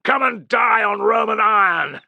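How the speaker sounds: high, loud defiant shout; roared challenge. Acted. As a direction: spit defiance at the enemy. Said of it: "roared Tullus".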